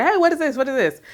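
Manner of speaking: put-on high, excited little-boy question; curious kid voice. Mother imitating her son asking about grandpa's package, high and eager.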